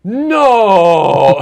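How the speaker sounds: high, loud yelp of denial; panicked denial. Retold or imitated. Caught brother denying he broke the window, loud high yelp. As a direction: protest your innocence hard. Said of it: "And then he goes".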